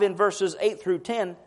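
ordinary delivery

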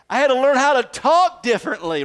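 ordinary delivery

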